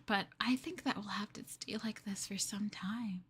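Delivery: soft whisper; whispered prediction. Acted. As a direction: let it fall to a hush. Confiding to captive partner.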